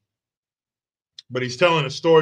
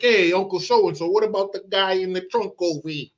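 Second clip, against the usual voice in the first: higher-pitched bad Italian accent; Steven Seagal; 4 semitones higher than usual. Asking mobster uncle about the trunk, bad Italian accent. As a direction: imitate a hammy movie Italian accent. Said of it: "He is the worst Italian accent".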